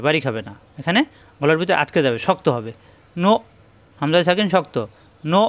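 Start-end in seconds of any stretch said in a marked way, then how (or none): none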